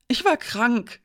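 blurting under pressure; blurted out. A narrator's reading. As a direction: burst out with it defensively. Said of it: "stieß er aus".

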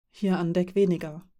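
plain reading voice

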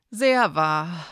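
sighed. Acted. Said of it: "seufzte Elena".

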